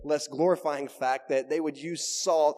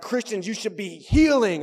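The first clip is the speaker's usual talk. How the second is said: higher, louder pulpit delivery, "healing" punched; preaching; 5 semitones higher, 6 dB louder than usual. Acted. Preacher demonstrating a possible sermon point, preaching it loud. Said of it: "Man, you can preach on those".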